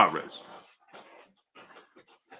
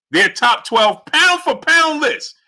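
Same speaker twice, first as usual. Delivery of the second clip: higher, slow, stretched-out announcement; dramatic slow reveal; 9 semitones higher, slower than usual. Real, not acted. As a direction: draw each word out like a big reveal.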